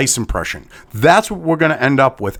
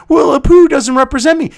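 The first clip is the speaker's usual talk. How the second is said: mock complaint; 10 semitones higher, 4 dB louder than usual. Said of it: "was like".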